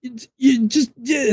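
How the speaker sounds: high, stammering child imitation; flustered kid. Imitating his four-year-old lost for words, flustered sputter. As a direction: sputter helplessly in a high little voice. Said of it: "they get so flustered".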